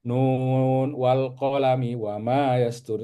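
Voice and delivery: slow recited verse in Arabic; Quran recitation. Acted. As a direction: chant the verse slowly and evenly.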